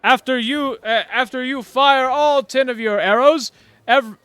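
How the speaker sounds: loud, grand tournament announcer voice; booming announcer. Acted. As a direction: proclaim it to the whole crowd.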